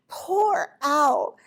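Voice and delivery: ordinary delivery, nothing marked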